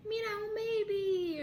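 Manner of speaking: high, slow gushing; imitating cooing strangers. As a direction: coo over a baby in a high voice.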